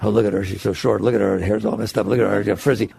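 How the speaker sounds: a judgmental onlooker picking at looks; judgmental gossip. Retold or imitated. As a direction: sneer at her like a petty critic. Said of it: "very judgmental about other people".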